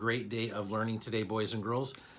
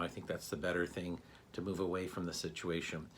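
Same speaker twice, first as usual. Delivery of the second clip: soft, low, reassuring inner voice; calm self-talk; 6 semitones lower, 7 dB quieter than usual. Shown as a demonstration. Principal models calming self-talk for the students, soft and low. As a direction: talk yourself down gently and softly. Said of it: "thinking about it and going".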